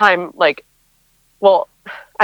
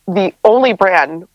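usual speaking voice

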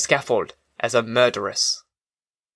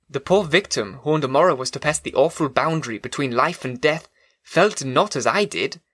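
plain reading voice

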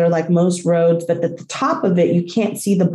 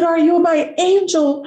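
high, overwhelmed question; retold awe; 9 semitones higher than usual. Dog owner asking rescuer on the grass, retold awe. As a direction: ask in amazement, voice well up in pitch.

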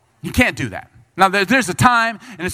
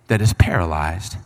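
usual speaking voice

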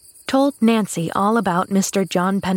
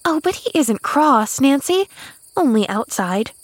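put-on higher-pitched girl protesting; loyal demurral; 6 semitones higher than usual. A narrator's reading. Girl defending a cross man, loyal protest. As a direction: gently object as a loyal child. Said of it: "demurred Pollyanna with quick loyalty".

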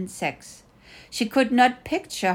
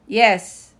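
usual speaking voice

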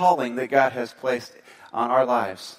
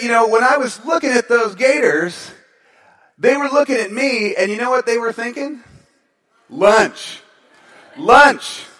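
higher, louder dramatic telling; higher loud storytelling shout; dramatic exclaiming; 6 semitones higher, 5 dB louder than usual. Retold or imitated. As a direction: build up to the punchline. Speaker retells gator fear dramatically.

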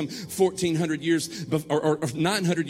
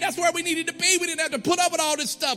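high, loud complaining rant; griping that they ever left; frustrated Peter; 8 semitones higher, 4 dB louder than usual. Retold or imitated. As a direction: Peter voiced as an exasperated complainer, raised and loud. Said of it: "And Peter's like".

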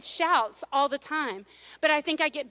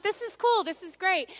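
higher-pitched mock delight; sarcastic cheer; 5 semitones higher than usual. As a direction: feign being thrilled at a disaster. Sarcastic reaction to burst pipes.

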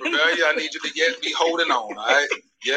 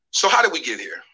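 normal talking voice

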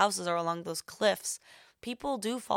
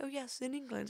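higher, much softer put-on British accent; fake British accent; 6 semitones higher, 10 dB quieter than usual. Friend answering the Uber driver in a fake British accent. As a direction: keep the British bluff going. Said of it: "Bia speaking in a British accent".

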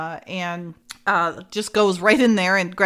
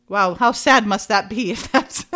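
usual speaking voice

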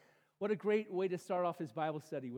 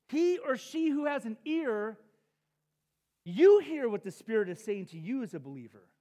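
preaching; 4 semitones higher, 9 dB louder than usual. Real, not acted. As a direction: proclaim it to the room. Preaching the key verse.

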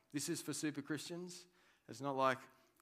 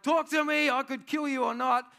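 much higher and louder threatening bark; Roman governor's demand; 13 semitones higher, 13 dB louder than usual. Retold or imitated. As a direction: shout down at a prisoner. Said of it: "this Roman governor official, is like".

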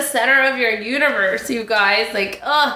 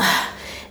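dreamy sigh; 5 dB quieter than usual. Real, not acted. Swooning over Anthony.

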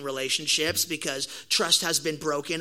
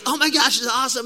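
excited; 7 semitones higher, 6 dB louder, faster than usual. Retold or imitated. Reacting to the crash, filming.

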